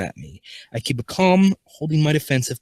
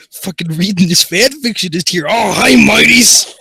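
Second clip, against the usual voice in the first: startled shouting; 10 semitones higher, 9 dB louder than usual. Real, not acted. Reader interrupted while reading fan fiction, startled shouting. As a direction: rant loudly, then holler a greeting.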